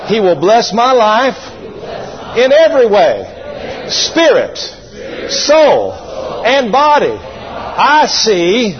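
slow, rising declaration of faith; preaching creed, slow and swelling; congregation recital. Shown as a demonstration. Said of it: "Everybody say this out loud".

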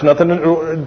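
ordinary talk, nothing marked

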